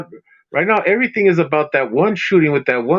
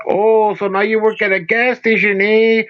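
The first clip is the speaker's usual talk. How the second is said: teasing put-on Chicano accent; mock Mexican accent; 2 semitones higher than usual. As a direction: tease in a hammed-up Mexican accent. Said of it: "making fun of accents".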